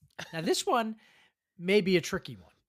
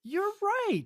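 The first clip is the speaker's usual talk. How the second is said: thrilled, much higher pitched; excited; 14 semitones higher than usual. Real, not acted. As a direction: burst out with delight at the answer. Host excited by correct answer.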